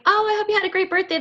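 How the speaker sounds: sweet and bubbly; mock sugary friendly; sweet greeting. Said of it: "when I'm like".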